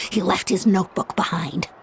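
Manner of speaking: ordinary talk, nothing marked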